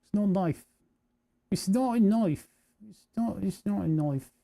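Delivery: light Aussie film-quote voice; Australian accent. Pretend. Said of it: "I cannot do the Australian accent".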